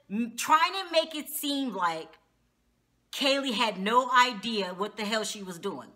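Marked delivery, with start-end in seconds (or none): none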